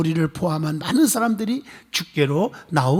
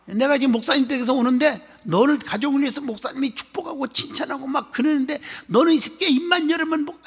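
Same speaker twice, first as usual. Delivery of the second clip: imitated scolding; 8 semitones higher than usual. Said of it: "그 사람 흉내내는 거".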